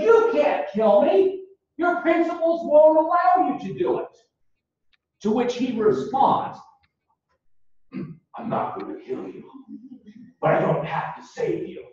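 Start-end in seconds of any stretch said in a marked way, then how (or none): 0.0-4.2 high-pitched taunt, acting out a movie villain; mock him in a raised, taunting voice; imitated villain's jeer, pitched well up. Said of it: "he kind of mocks Batman". 7.9-11.8 hushed, whispered Batman voice; imitated superhero's quiet, voiceless growl; Batman impression. Acts out Batman's reply.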